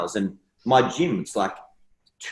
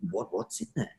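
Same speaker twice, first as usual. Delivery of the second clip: ordinary talk, nothing marked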